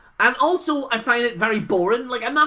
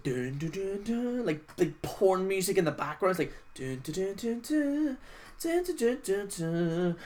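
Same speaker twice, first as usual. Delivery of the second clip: hummed music; 9 dB quieter than usual. Retold or imitated. Imitating cheesy background music.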